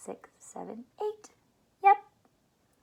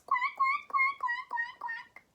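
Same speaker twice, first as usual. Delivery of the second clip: very high, tiny duckling quacks; very high little duckling quacks, fading; baby duck quacks; 5 dB quieter than usual. Shown as a demonstration. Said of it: "the babies probably sound like this".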